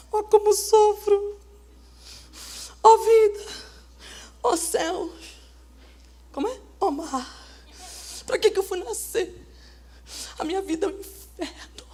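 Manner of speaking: quiet, sighing mock lament; mock self-pity lament. Preacher mocking a self-pitying sister, quiet whining lament. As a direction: play the whining victim, hammed up.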